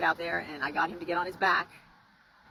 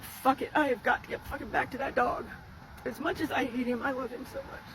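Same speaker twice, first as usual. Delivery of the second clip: holding back tears; 6 semitones higher than usual. Real, not acted. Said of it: "I'm gonna try not to cry".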